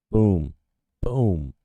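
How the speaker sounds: loud, punchy shout of triumph; hyped double boom; excited boom. Real, not acted. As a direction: bark it out like a win. Pumped over a chart spike.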